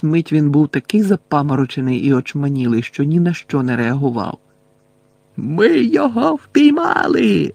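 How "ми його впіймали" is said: high, drawn-out triumphant holler; горлання. A narrator's reading. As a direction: holler it slowly to distant allies. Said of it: "загорлав найближчий до Гаррі смертежер". (протяжне високе горлання)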